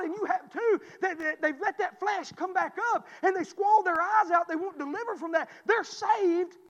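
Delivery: full-force revival preaching. Acted. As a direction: preach it out to the whole church.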